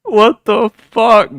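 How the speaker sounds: loud, high, drawn-out startled curse; startled outburst. Real, not acted. Streamer spooked by a creepy message, loud drawn-out startled curse.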